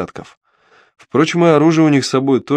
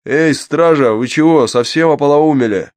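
loud indignant shout, higher; exclaiming loudly; 3 semitones higher, 5 dB louder than usual. Acted. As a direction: call out at the guards. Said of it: "не воскликнул".

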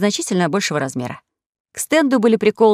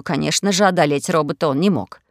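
ordinary delivery, nothing marked

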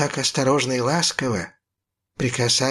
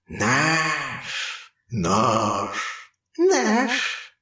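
pirates growling; much slower than usual. A narrator's reading. Pirates reach for the boy, growling that he is theirs. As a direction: snarl it like a beast closing in. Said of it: "зарычали пираты".

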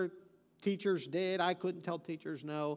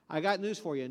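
normal talking voice

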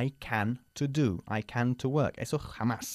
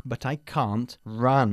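slow model answer; 4 dB louder, much slower than usual. Shown as a demonstration. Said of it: "slow model".

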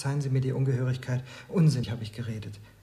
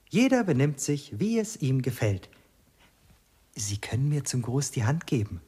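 normal talking voice

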